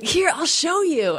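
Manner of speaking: lifted high and slowed, showing her; a high, slow half-asleep offer; high, slow coaxing offer. Retold or imitated. To her scared friend at night, a high slow coaxing offer. Sleep-talking, offering to prove it.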